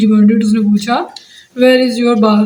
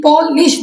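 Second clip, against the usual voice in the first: "Polish" split as पॉ, लिश; "polish" with a small break after पॉ; two syllables; 4 semitones higher, slower than usual. Shown as a demonstration. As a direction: say "polish" in two distinct beats. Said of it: "दो बन गए".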